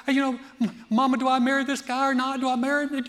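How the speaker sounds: hammed-up daughter plea; mock daughter asking. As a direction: ask mama like an anxious girl. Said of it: "It's like".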